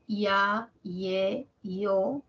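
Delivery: ya, ye, yo each as one syllable; diphthong demo. Shown as a demonstration. Teacher modelling rising diphthongs for students, clearly. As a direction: say ya, ye, yo with the vowel carrying the force. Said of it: "por ejemplo, en el diptongo creciente".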